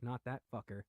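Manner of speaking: ordinary talk, nothing marked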